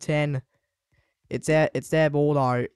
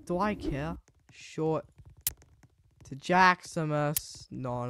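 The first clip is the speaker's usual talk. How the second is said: ordinary talk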